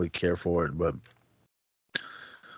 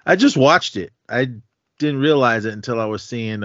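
ordinary delivery

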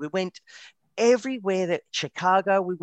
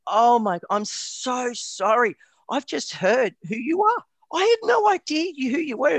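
high-pitched, gushing retold apology; acted-out embarrassment, emphatic and high; mortified apology; 9 semitones higher, 3 dB louder than usual. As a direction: plead forgiveness, voice climbing higher and higher. Acted mortified apology to celebrity groom.

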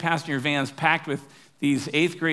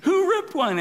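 very high mock kid call; high, squeaky mock holler; retold yell; slower than usual. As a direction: imitate a middle schooler calling out in falsetto. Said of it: "somebody yells out".

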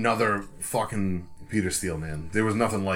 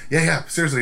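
higher, mock-excited agreement; eager little kid; 6 semitones higher, 4 dB louder than usual. Wife agreeing to the request, kid-like eagerness. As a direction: imitate an eager kid, pitched up. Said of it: "Like a little kid".

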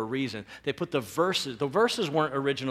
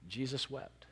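hushed Jesus wept; 10 dB quieter than usual. Shown as a demonstration. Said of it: "in two words".

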